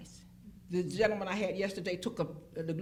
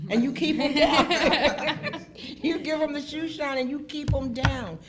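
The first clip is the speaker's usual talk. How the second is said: laughing; 7 semitones higher, 9 dB louder than usual. Real, not acted. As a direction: savour the line through a big laugh. Laughing at her own punchline.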